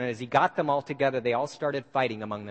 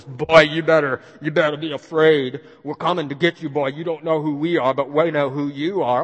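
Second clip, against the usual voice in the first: louder, put-on threatening caller voice; a Klansman disguises his voice to threaten Watts on the phone; disguised menacing voice; 6 dB louder than usual. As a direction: mask your voice like an anonymous phone threat. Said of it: "disguised his voice".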